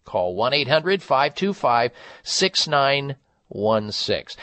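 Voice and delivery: ordinary talk, nothing marked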